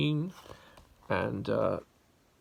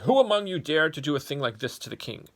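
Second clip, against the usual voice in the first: loud, higher angry demand; roaring king; 5 semitones higher, 9 dB louder than usual. Acted. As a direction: roar at the gathered insects. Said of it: "the king roared".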